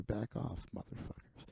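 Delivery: hissed under the breath; whispered aside. Real, not acted. Checking emails, whispers at the creditors. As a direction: mutter it so nobody hears.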